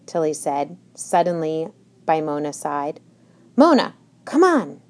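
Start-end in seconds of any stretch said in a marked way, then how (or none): 3.6-4.8 hissed through gritted teeth; urgent whisper through clenched teeth. A narrator's reading. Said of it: "whispered through clenched teeth".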